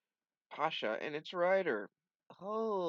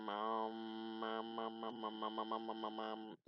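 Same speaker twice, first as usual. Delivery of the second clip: stalling drone; 7 dB quieter, slower than usual. Pretend.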